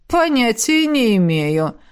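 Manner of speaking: feigned innocence, slow; innocent tone. A narrator's reading. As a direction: play dumb, all innocence. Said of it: "Голос духа приобрёл невиннейшие нотки". (невинным тоном)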